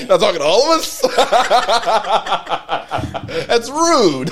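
laughing hard while mocking. Real, not acted. Friends joking about Southern speech, laughing throughout. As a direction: giggle through the mockery of outsiders. Mocking outsiders saying you guys.

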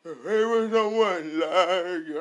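high loud shout, then slow; amazed shout. Retold or imitated. Dad overwhelmed by his child's antics, loud and high. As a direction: gush in exaggerated awe.

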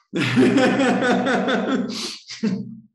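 loud high real laughter; burst of laughter. Real, not acted. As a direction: crack up loudly at the absurd story. Laughing at the doctor's reply.

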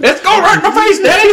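put-on high, whiny little boy; childish high voice. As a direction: squeal like a kid getting sprayed. Playing the boy at the hose.

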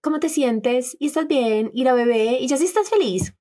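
high, sing-song mock-caring questions; mock pestering concern. She imitates friends pestering her after the loss, high and sing-song. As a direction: mimic nosy friends piling on questions. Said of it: "pero no era".

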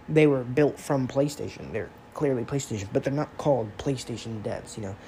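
usual speaking voice

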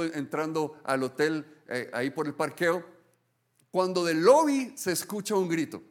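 normal talking voice

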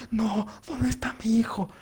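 high, slow mock wail of a mother; mother searching for her son, in a mock tearful wail; imitating crying mother. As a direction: plead like a frantic mom, hammed up. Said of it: "Sale así llorando".

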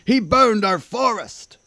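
loud, high indignant accusation; crying out. A narrator's reading. King accuses dragon of burning forest, loud high cry. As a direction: hurl the blame, loud and drawn out. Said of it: "he cried".

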